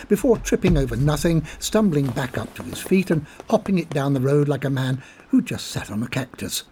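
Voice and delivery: ordinary delivery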